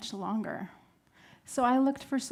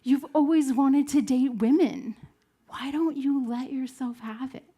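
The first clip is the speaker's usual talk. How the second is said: louder, higher, insistent push; inner voice shouting; 4 semitones higher, 6 dB louder than usual. Retold or imitated. Whispering inner voice finally speaks up to her, shouting. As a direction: raise the voice like a nagging conscience. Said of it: "that whispering voice ... started to shout".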